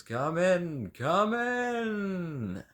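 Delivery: high, sweeping showman welcome; theatrical welcome. Acted. Host inviting the player in, grand theatrical welcome. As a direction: roll out the welcome like a stage host.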